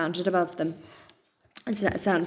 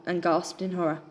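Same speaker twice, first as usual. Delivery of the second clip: usual speaking voice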